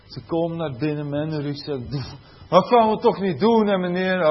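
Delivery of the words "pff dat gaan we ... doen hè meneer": loud, higher, dismissive student whine; hammed-up unwilling pupil; mock bored teenager. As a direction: sneer it like a lazy teen brushing off the teacher. Teacher voicing bored teenagers.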